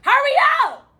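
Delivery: high, loud shout of urgency. Real, not acted. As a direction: yell at the players to hurry. Urging players, clock running.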